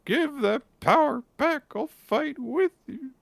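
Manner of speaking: put-on high, slow falsetto young hero; Gakuro's voice. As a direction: squeak it out as the eager boy. Voicing Gakuro in recap.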